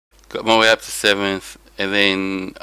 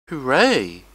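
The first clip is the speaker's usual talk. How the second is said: higher-pitched little hooray; mock cheer; 5 semitones higher, 4 dB louder than usual. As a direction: cheer the points in a playful, falling hooray. Cheers own race points gained.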